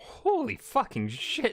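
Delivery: high, slow stunned outburst; shocked exclamation. Real, not acted. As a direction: blurt it out in total disbelief. Shocked by gun mousetrap.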